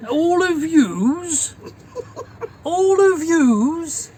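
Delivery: high, drawn-out mock disbelief, loud; correcting father. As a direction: imitate an appalled dad repeating bad grammar. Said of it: "he'd be correcting me on grammar".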